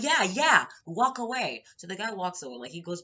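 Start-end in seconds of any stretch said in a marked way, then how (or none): 0.0-1.6 high, loud shooing-off; acted sharp, high brush-off; loud dismissal. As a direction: send someone away with raised voice.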